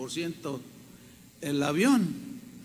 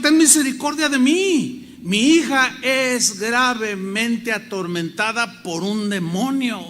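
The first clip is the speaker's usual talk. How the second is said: loud, high cry for mercy; clamor suplicante; 5 semitones higher, 8 dB louder than usual. Acted. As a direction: cry out to be heard, pleading at full voice. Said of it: "ella empieza a clamar". (clamor a gritos, suplicando)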